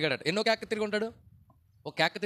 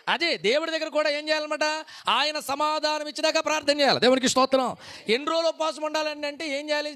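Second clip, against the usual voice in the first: high, loud revival preacher's cry, with a laugh; preaching at full force; 7 semitones higher, 5 dB louder than usual. Acted. Pastor rouses the congregation to pray till answered, loud fervent preaching. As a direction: whip the congregation up, praising God loudly.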